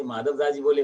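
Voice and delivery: ordinary delivery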